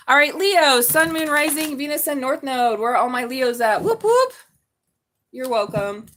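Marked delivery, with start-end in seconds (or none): 3.8-4.3 high, loud party whoop; high, loud celebratory whoop; whoop whoop cheer. Real, not acted. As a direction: whoop it up like a hype crowd. Cheering for Leos.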